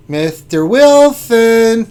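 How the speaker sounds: high, loud, drawn-out call; playful hail. Pretend. Streamer greets good friend's request, playful drawn-out holler. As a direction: stretch the name out in a hammed-up shout.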